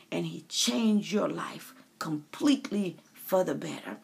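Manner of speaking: hushed whisper of the promise. Real, not acted. Preacher whispering to the listener how God changes lives. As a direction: whisper it as a secret blessing. Intimate sermon aside.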